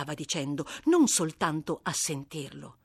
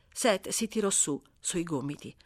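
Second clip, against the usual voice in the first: ordinary delivery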